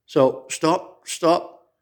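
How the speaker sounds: usual speaking voice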